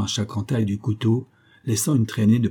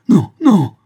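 loud, high-pitched shout; anguished shouted refusal; desperate cry; 10 semitones higher, 12 dB louder than usual. A narrator's reading. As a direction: cry out to stop him at once. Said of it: "cria Bellingham".

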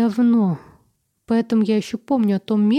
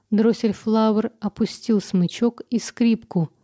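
plain reading voice

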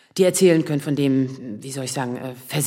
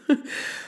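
soft laugh; 6 dB quieter than usual. Acted. Funny anecdote about Samir.

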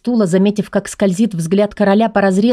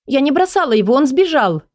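put-on protesting young woman; 6 semitones higher than usual. A narrator's reading. Heroine protests to the king.

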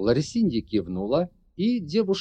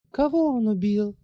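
hoarse; 11 semitones higher than usual. Acted. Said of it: "прохрипела Ларри".

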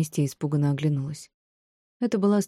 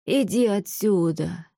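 slow, high wail; drawn-out old-woman wailing; 4 semitones higher, much slower than usual. A narrator's reading. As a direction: lament it, dragging the words out. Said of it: "запричитала женщина".

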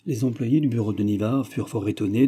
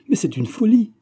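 much higher, loud alarmed cry; cried out; 12 semitones higher, 6 dB louder than usual. A narrator's reading. Laurence crying out at Xavier's reckless plan, loud. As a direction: cry it out, hands clasped. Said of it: "s'écria-t-elle".

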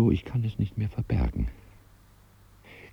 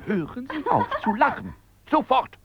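high-pitched indignant command; angry order. Acted. Customer to laughing saleswoman, angry. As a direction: order them to stop at once.

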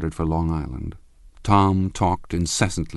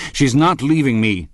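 raised and insistent, claiming his wife; forceful outburst; 7 semitones higher, 6 dB louder than usual. Acted. Husband to rival over his wife, loud and possessive. Tom confronts Gatsby over Daisy.

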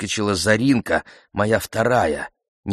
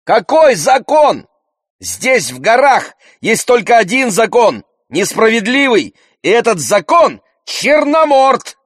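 high, loud outraged tirade; furious protest; 8 dB louder than usual. A narrator's reading. Child scorns mother's talk of law, furiously. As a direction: shout bitterly, drawing out the final word.

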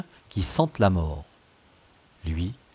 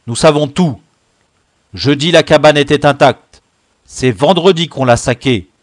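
forceful chief's interrogation; loud accusation; 4 semitones higher, 11 dB louder than usual. Acted. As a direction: lay out the charge at full voice. Chief loudly sets out the accusation. (accuser avec autorité, d'une voix forte)

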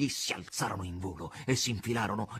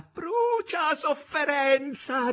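high, loud, drawn-out exultant cry; 6 dB louder, much slower than usual. A narrator's reading. Margherita burning the past, high wild cry. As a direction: shriek it out and stretch the last word. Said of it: "gridava Margherita".

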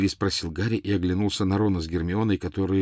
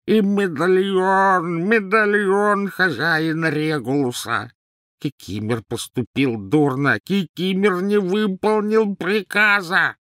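much higher, louder, slow elf wail; bloodcurdling wail; 10 semitones higher, 4 dB louder, slower than usual. A narrator's reading. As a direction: howl out the lost locket. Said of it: "издал леденящий крови вопль". (леденящий душу вопль эльфа, высоко и медленно)

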